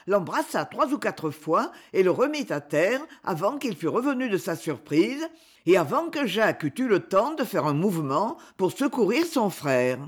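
usual speaking voice

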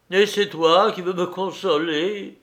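anguished protest to a friend; moaning. A narrator's reading. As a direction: moan in helpless distress. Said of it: "gémissait Arcade, accablé".

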